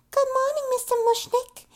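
put-on very high, mousy New York accent; Audrey. Blonde woman walks up to greet her boss, mousy impression. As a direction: squeak it out sweet and shy. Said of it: "speaks in that really high, mousy New York accent".

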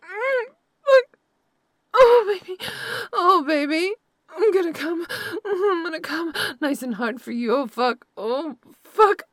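climaxing. Acted. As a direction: build up to a loud climax and let it break. Lover climaxing during sex.

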